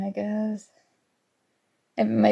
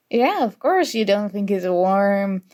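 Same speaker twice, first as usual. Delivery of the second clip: usual speaking voice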